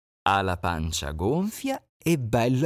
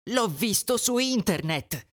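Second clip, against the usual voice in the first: higher little chick, sure of himself; 10 semitones higher than usual. Acted. Chick explaining to the duckling, higher voice. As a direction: say it like a proud chick.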